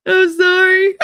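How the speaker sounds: a mock sob of an apology; tearful sorry. Apologizing through tears, retold.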